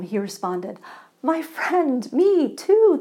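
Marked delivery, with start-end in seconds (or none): 1.2-3.0 higher and louder, delighted; overjoyed stranger finding a fellow believer; joyful proclamation. Acted. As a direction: proclaim it with glee. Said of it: "the man proclaimed".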